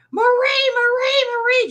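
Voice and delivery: high, loud desperate calling of Marie; screaming for help. Retold or imitated. Choking friend screaming for Marie from the couch. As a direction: scream her name down the hallway. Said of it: "just screaming".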